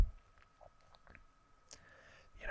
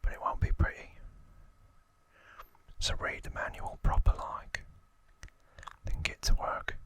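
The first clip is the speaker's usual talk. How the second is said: whispered Cockney gangster voice; Cockney accent. Acted. Albert Crisp warning a newcomer to London, whispered Cockney accent. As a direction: put on your best Cockney, all in a whisper. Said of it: "That's my best Cockney accent".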